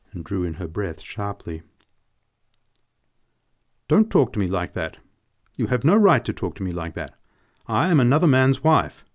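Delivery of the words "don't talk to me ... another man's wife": loud, high, offended; outraged refusal, louder toward the end; indignant protest. A narrator's reading. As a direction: shut him down with outrage. Woman rebuffing him indignantly.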